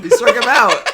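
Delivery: loud, laughing cheer; excited. Real, not acted. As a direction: celebrate the strikeout. Cheering a strikeout.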